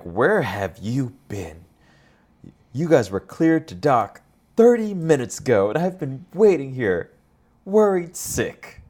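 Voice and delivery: a worried, nagging robot officer; K-2B4 impression. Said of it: "It's a pretty good impression of, uh, K two B four".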